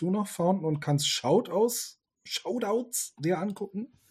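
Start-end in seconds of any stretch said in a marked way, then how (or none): 2.2-4.0 voice giving out. Real, not acted. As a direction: voice cracking and failing. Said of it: "Meine Stimme versagt leicht".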